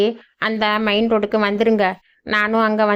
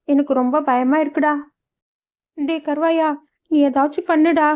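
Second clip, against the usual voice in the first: put-on higher voice, worried old mother; frightened old mother; 6 semitones higher than usual. Old mother begging Karuvaya for help, higher and frightened. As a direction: plead like a scared village mother.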